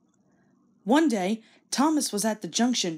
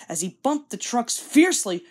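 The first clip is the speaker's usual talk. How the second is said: usual speaking voice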